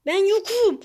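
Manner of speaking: high, loud, panicky insistence; pleading shout. Real, not acted. Pleads with opponent, repeats louder. (telaşla, tiz ve yüksek sesle bağırarak)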